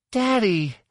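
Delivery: high, gleeful cry; joyful greeting. A narrator's reading. Daughter running into her father's arms, high joyful cry. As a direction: cry out with delight, pitch way up.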